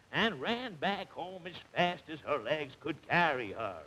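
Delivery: normal talking voice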